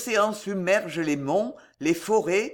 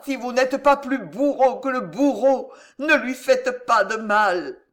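raised, urgent supplication for a lover's life; anguished plea, pitched up and insistent; desperate pleading; 4 semitones higher, 3 dB louder than usual. A narrator's reading. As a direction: beg them to spare him. Desperate plea for her fiancé.

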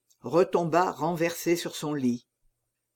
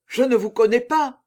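higher, louder protest; panicked cry; 5 semitones higher, 5 dB louder than usual. A narrator's reading. Executioner protests to the false monk, loud high cry. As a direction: cry out in denial. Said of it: "s'écria le bourreau".

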